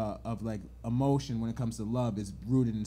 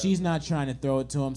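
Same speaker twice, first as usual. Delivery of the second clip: ordinary talk, nothing marked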